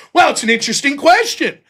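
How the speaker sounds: mock speechifying, slowing toward the end; stalling debater. Imitating an opponent dodging a yes-or-no question, loud stalling.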